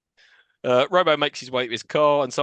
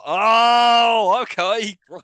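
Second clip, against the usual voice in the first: loud, high, drawn-out "oh"; mock realisation; 6 semitones higher, 6 dB louder, much slower than usual. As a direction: shout it as if the obvious just dawned. Mocking an over-obvious scene.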